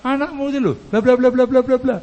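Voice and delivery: high mock complaining chatter; wife venting. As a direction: imitate a wife pouring out complaints. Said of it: "Nanti dia akan curhat".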